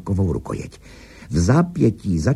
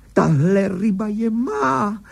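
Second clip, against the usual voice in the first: high, excited cry of triumph; excited exclamation; 12 semitones higher than usual. A narrator's reading. Angler claiming the fish on the line, excited. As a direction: call out eagerly, pitched high. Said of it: "zvolal nadšeně Higgins".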